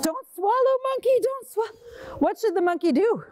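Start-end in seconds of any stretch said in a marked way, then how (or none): 0.0-2.2 high, pleading call; urgent plea. Acted. Storyteller pleading with the monkey, high and urgent. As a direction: beg the monkey not to swallow.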